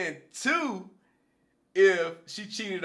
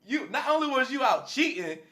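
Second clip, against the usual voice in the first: mock scolding; 6 semitones higher, 4 dB louder than usual. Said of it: "'Cause I'll be like".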